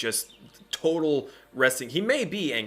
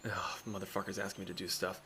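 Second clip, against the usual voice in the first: annoyed grumble; 3 semitones lower, 12 dB quieter, faster than usual. Acted. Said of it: "He kinda has this look of like".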